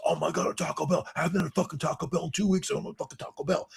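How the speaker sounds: high, excited gushing over fast food; mock-frantic Long Island teen; excited teen kids. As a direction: gush like a crazed teenager. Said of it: "Long Island kids went fucking nuts".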